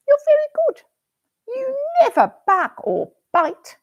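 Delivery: put-on high, sweet little girl praising her dog; little girl Susie praising her dog; girl's voice. Susie's voice in story.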